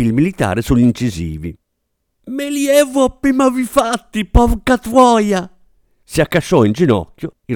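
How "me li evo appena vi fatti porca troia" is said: high, slow, slurred through broken teeth; a policeman with smashed incisors swears, lisping high and slow; toothless lisping. Acted. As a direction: curse through a mouth with no front teeth. Officer's front teeth knocked out.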